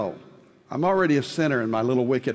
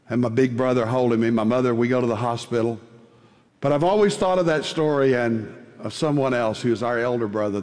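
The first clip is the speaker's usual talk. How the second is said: usual speaking voice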